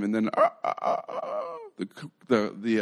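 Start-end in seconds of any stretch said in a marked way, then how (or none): 0.3-1.4 high, squawky mock cock-a-doodle; comic rooster call jumping way up in pitch; imitated rooster crow. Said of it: "rooster crows".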